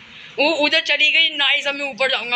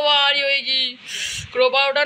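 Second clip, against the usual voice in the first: ordinary talk, nothing marked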